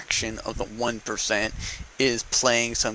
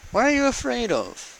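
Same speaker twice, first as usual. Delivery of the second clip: challenging question; 13 semitones higher than usual. Retold or imitated. Challenging a candidate over his tax returns, high-pitched needling. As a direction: pitch it way up, needling him to answer. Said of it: "we start asking a question".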